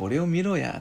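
high, drawn-out 'just look at me' bravado; cocky swagger. Shown as a demonstration. Teacher acts out the nuance of 好, cocky boast. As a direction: show off with a mock boast. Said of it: "それから表情".

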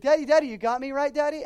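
put-on a small boy begging his daddy for reassurance; anxious little boy.

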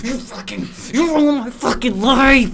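high, loud, slow ranting dad; hammed-up rage at the baby; mock angry yell.

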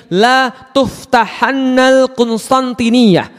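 tuftahannal drawn out slowly, its doubled n held; stressed doubled nun. Shown as a demonstration. Preacher guaranteeing the prophecy to doubters, tuftahannal with emphatic held n. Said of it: "nunnya kemudian ditasydid".

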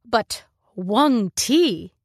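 slow, drawn-out sneering question. A narrator's reading. Mother questions her daughter's choice of refueling planet, sneering. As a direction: stretch the planet's name with disdain. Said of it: "There's a sneer in her voice".

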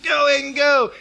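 high, loud cheering shout; cheering on son. Retold or imitated. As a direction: yell to your son running past in the woods. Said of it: "So I'm cheering him on".

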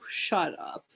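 whispered stunned shut up; disbelieving hush. Retold or imitated. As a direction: breathe it out in disbelief. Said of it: "and I was like".

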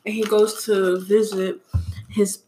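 ordinary talk, nothing marked

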